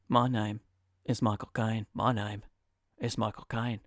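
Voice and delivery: low, soft Cockney with a pause after name; Michael Caine impression. Mic reviewer doing a lightly voiced Michael Caine, low soft Cockney.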